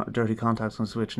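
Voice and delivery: usual speaking voice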